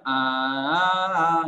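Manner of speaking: long loud "ah"; demo bunyi suara. Shown as a demonstration. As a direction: hold out an open "ah" as raw voice. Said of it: "Kalau suara itu kan yang". ("aah" panjang dan keras)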